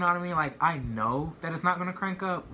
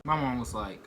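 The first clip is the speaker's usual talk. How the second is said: ordinary talk, nothing marked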